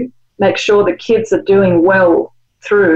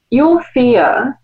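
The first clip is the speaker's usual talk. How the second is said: ordinary talk, nothing marked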